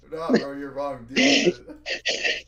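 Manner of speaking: loud, slow, smug know-it-all; hammed-up dismissive bro; mocking smug voice. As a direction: rub it in, laughing loud. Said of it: "I love that shit".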